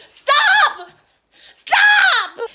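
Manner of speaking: long high yell after a bus; hammed-up desperate scream; desperate shout. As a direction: holler it out, high and drawn out. Calling out to stop bus.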